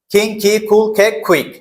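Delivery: কিং, কি, কুল, কে, কুইক with K like খ; aspirated K drill. Shown as a demonstration. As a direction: drill each word with a puffed K. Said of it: "এটা উচ্চারণ করবো আমরা খ এর মতো".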